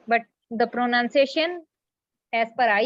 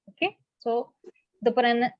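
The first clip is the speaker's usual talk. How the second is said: usual speaking voice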